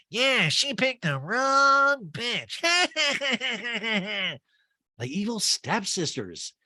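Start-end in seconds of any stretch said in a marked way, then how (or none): 0.1-2.6 high, slow, stretched-out taunt; hammed-up villainous glee; imitated gloating castmate. Acts out Erica's gleeful taunt. 2.7-4.4 high, long staccato cackle; put-on wicked laugh; evil stepsister cackle. Acted cackle, likened to evil stepsisters.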